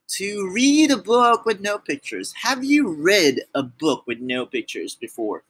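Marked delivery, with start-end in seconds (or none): none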